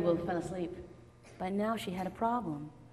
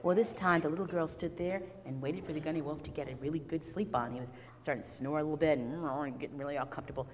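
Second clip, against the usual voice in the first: ordinary delivery, nothing marked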